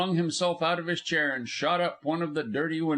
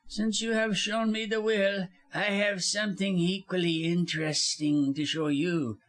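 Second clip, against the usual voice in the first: quiet menace; 6 semitones higher than usual. A narrator's reading. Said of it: "said he quietly".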